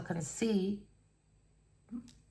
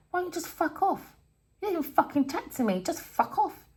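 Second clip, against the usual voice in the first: peeved squeal at usual volume; annoyed brush-off; 10 semitones higher than usual. Retold or imitated. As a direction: pipe up high, telling someone to leave you alone. Acts out annoyed retort.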